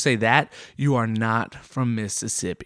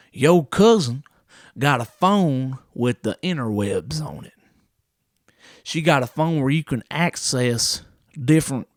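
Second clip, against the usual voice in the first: higher put-on country uncle voice, scoffing; mock Uncle Taters; 3 semitones higher, 3 dB louder than usual. Uncle scoffing at the nephew's phone, hammed-up country voice. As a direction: scoff like a proud backwoods uncle. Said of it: "And he said".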